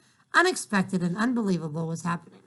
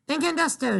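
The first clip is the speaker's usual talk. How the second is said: raised, excited voice; shouting a little; 8 semitones higher than usual. Acted. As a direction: call the name out proudly. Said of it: "she was shouting the tiniest bit".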